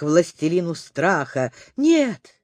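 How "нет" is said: high, panicked "no, no"; frightened cry. A narrator's reading. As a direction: cry out in terror. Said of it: "закричал Хрумс". (испуганный крик)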